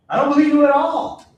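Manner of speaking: ordinary talk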